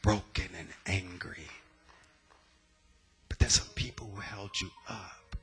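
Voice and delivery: hushed altar call. Acted. Pastor calling the hurting congregation, hushed whisper. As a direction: draw them in close and quiet.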